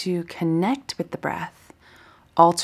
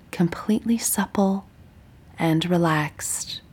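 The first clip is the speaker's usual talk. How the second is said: guided meditation. Acted. Teacher guiding listeners lying down through a body scan, meditative. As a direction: guide them gently through breath and body, unhurried.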